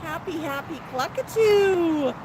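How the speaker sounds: high, long-drawn happy coo; playful bird talk. Pretend. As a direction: swoop up high as if praising a pet. Cheering on a bird outside.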